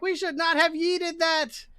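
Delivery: high-pitched gargoyle voice; high, mock-rueful creature; regretful gargoyle. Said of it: "Gargoyle four".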